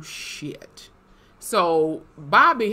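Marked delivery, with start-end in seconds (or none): 0.0-0.9 breathless whisper of alarm; whispered shit. Retold or imitated. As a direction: whisper it in dread. Realizing Bobby disappeared.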